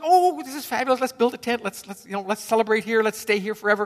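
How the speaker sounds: high, loud and gushing; eager disciple wanting to stay forever; excited Peter. Retold or imitated. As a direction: bubble over with delight like Peter. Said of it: "being Peter, says".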